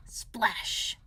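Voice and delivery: ordinary delivery, nothing marked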